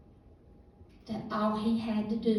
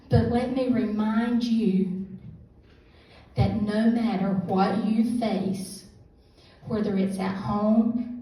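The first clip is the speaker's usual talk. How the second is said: louder, slower, urging the room; preaching; 6 dB louder than usual. Real, not acted. Speaker urging the church crowd not to give up, preaching. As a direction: press every line home to the listeners. Exhorts the room to keep faith.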